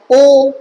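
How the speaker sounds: ओ said with round lips; rounded o vowel. Shown as a demonstration. Teacher showing the round shape of ओ. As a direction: hold ओ round and clear. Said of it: "गोल हुआ कि नहीं हुआ".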